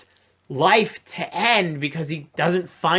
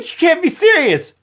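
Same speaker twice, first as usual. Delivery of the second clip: high loud disbelief; hammed-up villain protest; mock incredulous villain; 11 semitones higher, 6 dB louder than usual. Villain reaction voiced.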